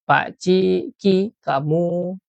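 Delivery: ordinary delivery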